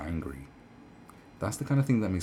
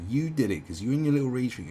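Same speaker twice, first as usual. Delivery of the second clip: ordinary talk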